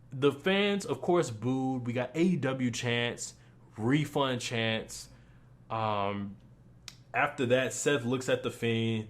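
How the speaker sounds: ordinary delivery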